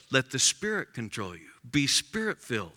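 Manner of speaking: ordinary talk, nothing marked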